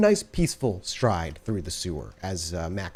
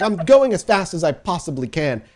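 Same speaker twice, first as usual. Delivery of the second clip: put-on higher and louder, defensive boy; flustered clerk; 7 semitones higher, 6 dB louder than usual. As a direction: protest under pressure. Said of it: "He's like".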